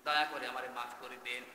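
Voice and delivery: low, softened voice of a repentant sinner; imitated tearful plea. Preacher voices a sinner begging God's forgiveness, mildly tearful. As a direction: lightly act a tearful confession, sinking low. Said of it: "কান্না জড়িত কণ্ঠে বলবে".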